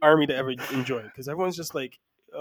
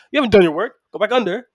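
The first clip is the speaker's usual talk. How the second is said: loud, raised-pitch imitated commands; mock bossy order; 5 semitones higher, 8 dB louder, faster than usual. Shipmate sending the hero back to shovel, mock bossy order. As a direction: build to a louder, higher last order, nearly laughing.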